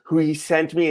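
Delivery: ordinary talk, nothing marked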